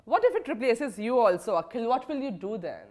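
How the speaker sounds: normal talking voice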